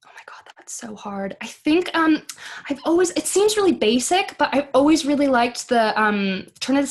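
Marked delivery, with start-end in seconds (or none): 0.1-1.4 under the breath, thinking hard; hushed reaction. Real, not acted. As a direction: whisper it, overwhelmed by the question. Stumped by a difficult question.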